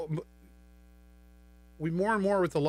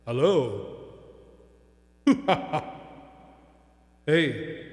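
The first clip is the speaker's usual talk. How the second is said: reverb effect; 4 dB louder, much slower than usual. Shown as a demonstration. Testing voice effects on mixer.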